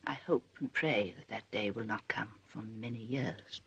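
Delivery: low, soft, grave wish; grave disapproval. Acted. Mother hoping the wedding is years away, grave. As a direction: drop low and quiet with dread.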